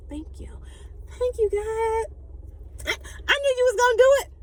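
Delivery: high, gushing thank-you, fast at the end; playful, giddy gratitude. Pretend. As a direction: squeal thanks like the prayer just came true. Mock-thanking God, giddy.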